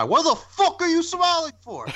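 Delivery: high, mock-aggressive snarl at a stranger; hammed-up angry Butcher voice; imitating Butcher. Podcast host mimics Butcher scolding smiling shoppers, mock angry. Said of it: "I could just imagine Butcher just walking by".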